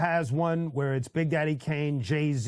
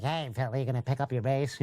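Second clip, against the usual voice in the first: nasal voice; faster than usual. Retold or imitated. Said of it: "he has a very nasal voice".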